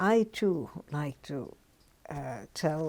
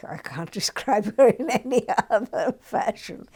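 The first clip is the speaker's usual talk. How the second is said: loud, higher, laughing through the words; laughing while talking; 6 semitones higher, 10 dB louder than usual. Real, not acted. Laughing at her own blunt verdict.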